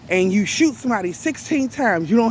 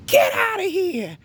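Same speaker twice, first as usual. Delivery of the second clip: mock scream; 12 semitones higher than usual. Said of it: "kept on screaming".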